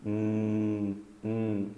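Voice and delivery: tongue on the palate, sustain hum through the nose; nasal N sound. Shown as a demonstration. Teacher demonstrates N, hum as a soft nasal drone. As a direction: hum held as a long nasal N. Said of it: "segura e faz um som nasala".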